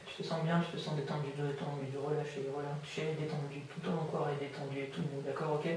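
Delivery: hushed, repetitive relaxation patter; hypnotic induction. Real, not acted. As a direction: softly coax the body into letting go. (voix d'hypnose douce et apaisante)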